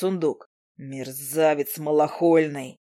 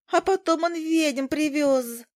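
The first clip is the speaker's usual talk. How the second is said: very high, slow squeaky boast; boasting fly; 12 semitones higher, slower than usual. Acted. Zhuzha boasting to Izi, very high and squeaky. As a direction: show off the news like a proud little bug. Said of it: "похвасталась Жужа".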